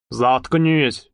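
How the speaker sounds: usual speaking voice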